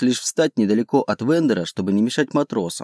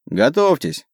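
higher-pitched brief command; called-out order; 4 semitones higher, slower than usual. A narrator's reading. Captain turns to his companions, giving a raised order. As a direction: warn the crew to get ready.

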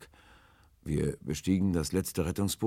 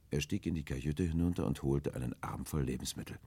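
ordinary delivery

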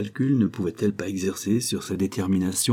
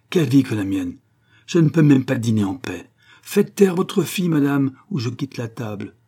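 loud, high exasperated outburst; exasperated outcry; 5 semitones higher, 5 dB louder than usual. Acted. The count shouts at his wife to silence the crying girl, exasperated. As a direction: throw up your hands and shout. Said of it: "il s'écria".

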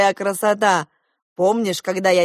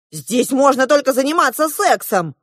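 higher, louder exclamation; exclaiming; 7 semitones higher, 6 dB louder than usual. A narrator's reading. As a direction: exclaim it in protest. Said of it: "воскликнула я". (громко восклицая)

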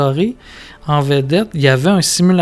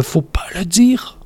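whisper; 3 semitones higher, 6 dB quieter than usual. Pretend. Telling a secret, asks silence.

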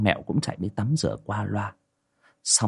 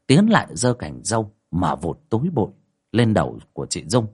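ordinary delivery, nothing marked

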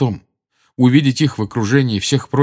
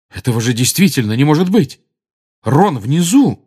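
ordinary delivery, nothing marked

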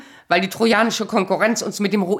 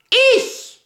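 a sharp, high, loud cry of "Ich!"; indignant outburst; 14 semitones higher, 3 dB louder than usual. Acted. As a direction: fling the word back at him. Indignant retort about the house.

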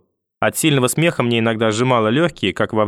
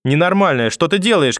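alarmed shout; 6 semitones higher than usual. Acted. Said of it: "крикнула мама". (испуганный крик)

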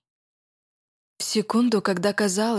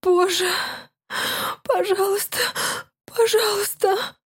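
gasping, much higher desperate begging; breathless pleading; 11 semitones higher than usual. Acted. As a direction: beg over and over, out of breath. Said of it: "повторяла, задыхаясь".

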